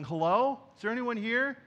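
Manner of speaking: tentative call for someone to serve you; acted questioning hello. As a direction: call across an empty counter, rising. Acts calling for counter staff.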